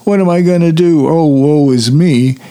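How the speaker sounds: hammed-up, high-pitched boo-hooing; mock crying. Retelling Dorothy stranded without the balloon, mock crying. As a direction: wail it theatrically, easing down in pitch. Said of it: "and she's crying".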